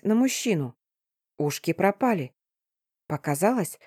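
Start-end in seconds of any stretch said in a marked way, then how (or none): none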